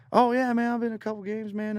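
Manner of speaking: high-pitched imitation of an Oklahoma accent; big Oklahoma accent. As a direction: play the chatty Oklahoma driver, accent up high. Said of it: "he's got this big old accent".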